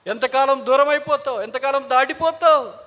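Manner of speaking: loud, high pleading questions; impassioned cry. Acted. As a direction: call out to God at full force. Cries out "how long" to God.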